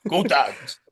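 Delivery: lightly voiced, slow gruff coach; a hint of a hoarse old coach; Coach O impression. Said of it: "I can do the Coach O impression".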